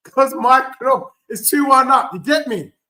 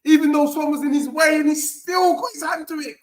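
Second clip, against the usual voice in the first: normal talking voice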